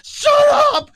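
full-out high, loud screaming at the radio; re-enacted scream. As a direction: scream it as if losing it in the car. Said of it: "Screaming in my car".

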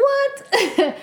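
a burst of high incredulous laughter; shocked giggle. Retold or imitated. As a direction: laugh in disbelief, high and short. Laughs at twin news.

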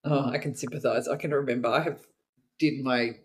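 ordinary talk, nothing marked

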